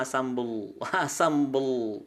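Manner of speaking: "sambel" ending in a long dark l; thick final l. Shown as a demonstration. Teacher practising thick lam through everyday food, long-l "sambel". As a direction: slowly hold the heavy l of "sambel". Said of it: "Biasakan sambel".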